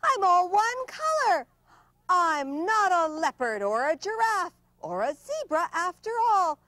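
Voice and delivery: high, delighted exclamation; excited discovery. Acted. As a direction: burst out in happy amazement. Zebra discovers it's a horse.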